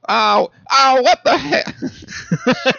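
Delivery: very high, slow drawn out echo with a laugh; laughing high cartoon echo. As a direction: stretch it high like a cartoon copy. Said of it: "trying to harmonize with you".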